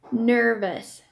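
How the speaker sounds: plain reading voice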